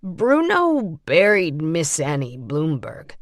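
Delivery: repeating his line carefully; Lawrence's voice. A narrator's reading. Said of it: "Lawrence said".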